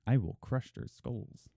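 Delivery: barbarian. Pretend. Threatening crabs, Conan-like boast.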